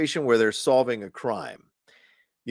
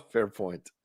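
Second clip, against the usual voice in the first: fair point said through a laugh; amused agreement, laughing; 4 dB quieter than usual. Real, not acted.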